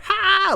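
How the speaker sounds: a sudden very high "ha"; cracking up at the joke; burst of laughter. Real, not acted. Vlogger laughing at Montez Ford's roast, sharp and high.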